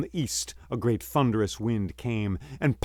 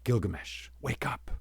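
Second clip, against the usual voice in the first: whispered wake-up call; trying to wake a friend quietly; urgent whisper; 8 dB quieter than usual. Acted. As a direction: hiss it close to his ear. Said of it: "went to him and said".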